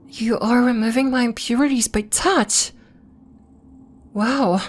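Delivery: mounting wonder, pitch swooping up on key words; amazed discovery. Acted. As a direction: marvel at what is happening. Amazed discovery of healing touch.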